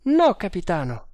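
high-pitched sergeant's exclamation; exclaiming. A narrator's reading. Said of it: "esclamò Bharata". (esclamazione acuta del sergente)

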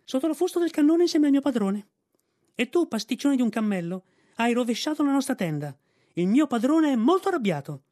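high, loud yapping little dog; Vixen. A narrator's reading. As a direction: scold like an indignant lapdog, pitched right up. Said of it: "abbaiò Vixen".

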